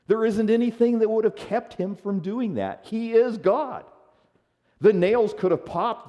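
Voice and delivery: high, loud, emphatic proclamation; full-force preaching. Real, not acted. Preacher declares Jesus could have left the cross, impassioned. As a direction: preach it out at the top of your range.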